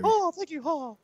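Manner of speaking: very high cartoon mouse squeak; failed attempt at a squeaky mouse voice; Mickey. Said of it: "You tried to go Mickey".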